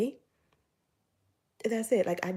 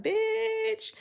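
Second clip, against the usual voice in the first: very high, drawn-out playful jab; exaggerated high-pitched imitation of a sassy retort; joking comeback; 14 semitones higher than usual. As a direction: sing it out in mock exasperation. Supervisor's joking comeback, acted out.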